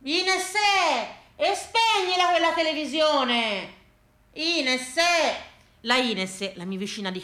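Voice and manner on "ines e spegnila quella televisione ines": high, drawn-out neighbourly yell; fed-up, high-pitched complaint; exasperated calling out. Acted. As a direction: call to the woman next door to switch it off.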